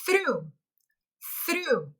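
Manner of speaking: "through" said twice, falling; teacher says "through" twice for repeating; model word. Shown as a demonstration. Teacher models "through" twice for students. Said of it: "The fourth word is".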